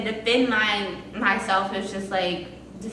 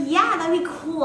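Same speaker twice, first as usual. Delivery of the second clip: a light high schoolgirl's yes; higher girlish voice; 9 semitones higher, 3 dB louder than usual. Retold or imitated. Amanda accepting an offer to play, in a higher voice. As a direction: pitch it up as the lonely girl. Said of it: "She was like".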